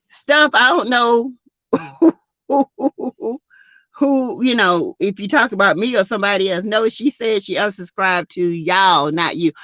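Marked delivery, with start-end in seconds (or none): none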